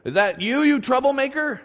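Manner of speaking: lightly voiced accuser, rising on troublemaker; accusing Ahab. Preacher voices Ahab confronting Elijah, accusing. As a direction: challenge him with mock accusation.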